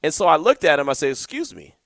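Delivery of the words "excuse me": calm, much softer excuse me; quietly composed excuse me; real calm. Retold or imitated. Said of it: "I just said it real calm".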